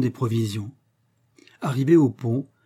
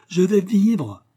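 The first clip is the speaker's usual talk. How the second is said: higher, louder cry, now pressing on "vais"; joyful cry; 8 semitones higher, 5 dB louder, much slower than usual. A narrator's reading. Totaro, cured, crying out again in joy. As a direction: repeat the shout, hitting "vais" hard. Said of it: "Puis il s'écria".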